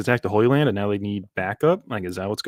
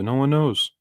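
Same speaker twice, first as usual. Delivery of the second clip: normal talking voice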